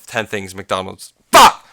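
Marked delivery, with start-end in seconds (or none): 1.3-1.7 sudden loud, high curse at his own slip; frustrated shout. Real, not acted. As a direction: blurt it out, fed up with stumbling. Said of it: "See, I told you, speech impediment".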